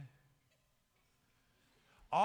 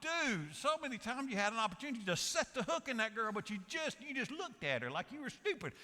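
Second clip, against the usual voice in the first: high-pitched, worked-up comic scolding; exasperated; 8 semitones higher than usual. Acted. Preacher telling off the TV vet who won't make a move, exasperated. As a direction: scold the hopeless suitor in mock exasperation. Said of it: "it drives me crazy".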